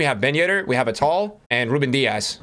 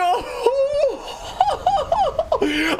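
high, long joyful laugh; ecstatic laugh. Real, not acted. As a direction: burst out laughing with joy. Laughs after the Zidane pull.

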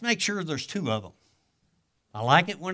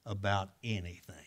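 whispered aside; 7 semitones lower, 8 dB quieter than usual. Real, not acted. Intimate aside to the audience.

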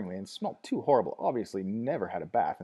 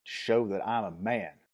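slow, resonant and proud; defiant young man; slower than usual. A narrator's reading. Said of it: "his voice suddenly took on a new resonance".

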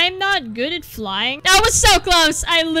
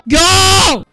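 loud yell rising to a high wail; frantic yell; 9 semitones higher, 8 dB louder than usual. Real, not acted. Gamer urging the character on, frantic loud yell. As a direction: scream it in desperation. Yelling during frantic game attempt.

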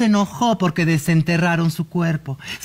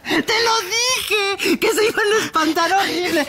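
laughing; 12 semitones higher than usual. Real, not acted. Laughing at frightened friend.

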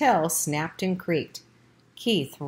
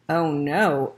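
echoing thought; 4 dB louder than usual. A narrator's reading. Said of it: "echoed Ralph's thoughts".

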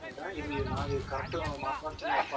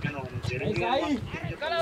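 angry; 7 semitones higher, 5 dB louder than usual. Real, not acted. Heated phone argument.

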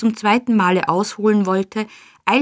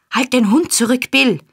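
urgent raised cry, higher pitched; shrieking; 5 semitones higher, 4 dB louder than usual. A narrator's reading. Nancy screaming at Bill about his dog, urgent shriek. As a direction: cry out a frantic warning. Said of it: "kreischte Nancy".

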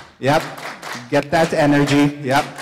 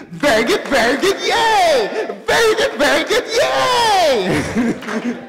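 much higher, louder, squealing very good, yay; excited childlike cheer; 13 semitones higher, 4 dB louder, slower than usual. Shown as a demonstration. Leader cheering with class, excited high childlike shout. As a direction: build to a gleeful shriek. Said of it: "Do the same energy".

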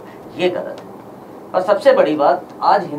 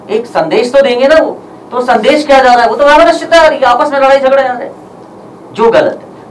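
loud, raised-pitch indignation; heated protest; 4 semitones higher, 7 dB louder than usual. Real, not acted. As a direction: press the accusation forcefully at high volume. Heated protest against the film's message.

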